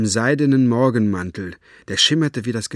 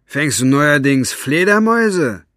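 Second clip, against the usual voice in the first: louder, higher, sneering impatience; impatient; 5 semitones higher, 6 dB louder than usual. A narrator's reading. Capricorn mocks Buster's catch, loud and impatient. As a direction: snap the question with scorn. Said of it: "Capricorns Stimme klang ungeduldig".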